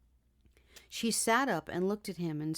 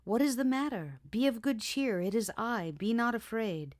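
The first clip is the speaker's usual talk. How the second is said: cheery and strong; 5 semitones higher than usual. Acted. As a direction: cheer her up with hearty strength. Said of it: "He sounded so cheery and full of strength".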